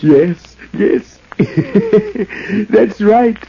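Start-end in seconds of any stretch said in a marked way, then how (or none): 1.3-2.7 mad cackle. Acted. Madman laughs at impersonations.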